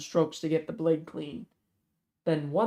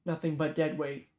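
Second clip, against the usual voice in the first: plain reading voice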